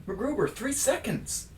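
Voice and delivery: high panicked countdown warning. Acted. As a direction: warn him in a panic. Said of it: "Please excuse my bad acting".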